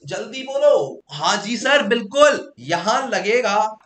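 high, loud push; excited urging. Real, not acted. Teacher pressing students for a fast answer, high and loud. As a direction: fire it off like a quiz host.

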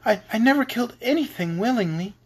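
put-on high, halting girlish protest; understated tearful plea; sobbing. A narrator's reading. Little girl protesting to Oz, mildly sobbing. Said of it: "she sobbed".

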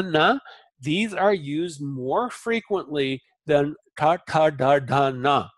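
teacher modelling Sanskrit consonants; syllable drill. Shown as a demonstration. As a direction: say each syllable clearly for students. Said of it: "teacher demonstrating consonants".

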